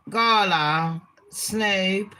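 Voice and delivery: usual speaking voice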